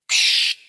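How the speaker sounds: short mouth hiss like an air brake. Retold or imitated. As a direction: spit out a quick burst of air. Mimics air brake release.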